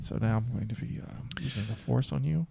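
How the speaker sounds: breathy ASMR whisper. Pretend. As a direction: whisper it close to the mic. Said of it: "A S M R".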